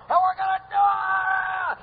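a mock hyped-up rally shout; mock hype shout. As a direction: ham up a hyped-up shout of "and we're gonna do it!". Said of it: "about shouting and screaming and charging".

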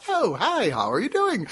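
high, bright hello in a mock cheery voice; sunny greeting. Greeting friends before the hills cut him off, mock cheery. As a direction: sing out a cheerful hi. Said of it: "You went from".

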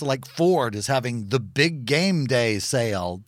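ordinary talk, nothing marked

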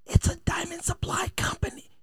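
ordinary talk

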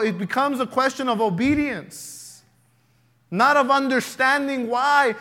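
rising sermon fervour; full-force preaching. Acted. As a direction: preach it out to the church.

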